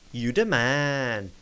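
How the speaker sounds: slow, drawn-out character voice; put-on praise, long and drawn out; happy game character. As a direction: play a pleased buddy, stretching the words right out. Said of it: "he's really happy with us now".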